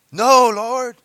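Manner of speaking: loud high protest; Peter's loud protest. Acted. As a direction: cry out in loud refusal.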